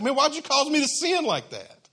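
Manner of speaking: a king demanding to know why he was tricked; indignant Pharaoh. Retold or imitated. Pharaoh scolding Abraham for the lie, high and indignant.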